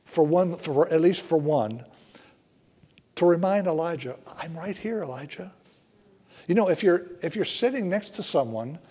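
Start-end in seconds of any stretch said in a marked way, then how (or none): 4.4-5.5 soft, close, God speaking gently; tender hush of reassurance; gentle quiet voice. Acted. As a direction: speak it like right beside him, softly. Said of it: "just quietly talk".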